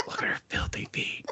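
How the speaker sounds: breathy hushed whisper of a judging onlooker; mock whispered gossip; gossipy whisper. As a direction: whisper it like a scandalized bystander. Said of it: "But they weren't that quiet".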